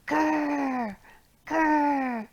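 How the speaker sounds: light playful grr grr; bear grr. Acted. Teacher growling along in the song, mock grr. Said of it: "you're gonna go, Grr".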